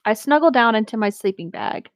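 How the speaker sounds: plain reading voice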